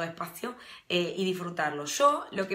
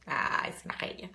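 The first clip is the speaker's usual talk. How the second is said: whispered aside; 4 semitones lower, 4 dB quieter than usual. Real, not acted. Whispered aside about viewer Vero.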